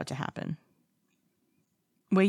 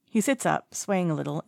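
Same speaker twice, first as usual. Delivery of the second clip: usual speaking voice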